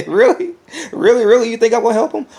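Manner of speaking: incredulous laugh. Acted. Vlogger scoffing at helping a rival, high incredulous laugh. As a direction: scoff at the very idea.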